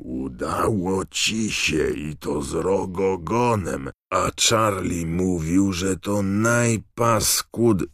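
slow, hoarse and building in excitement; Hagrid's hoarse voice. A narrator's reading. Friend amazed that Harry beat the Horntail, hoarse. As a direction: marvel hoarsely, stretching the words until cut off. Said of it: "powiedział Hagrid ochrypłym głosem".

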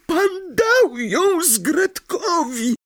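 very high elf voice, choked with tears; tearful elf joy. Acted. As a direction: squeak it out almost crying with joy. Freed elf overcome by joy.

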